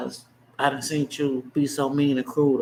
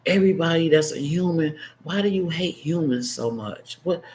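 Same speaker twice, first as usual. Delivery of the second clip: ordinary delivery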